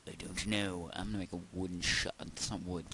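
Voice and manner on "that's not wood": soft, low-energy remark; subdued, softer grumble; low-key complaint. Real, not acted. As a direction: say it quietly to yourself. Muttered first take before the yell.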